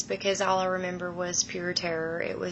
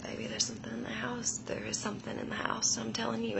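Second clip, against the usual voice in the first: fast, hushed warning; rushed scared whisper to her husband; urgent whisper; 2 semitones higher, 8 dB quieter, faster than usual. Retold or imitated. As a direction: whisper it so the thing can't hear. Warning husband something's in house.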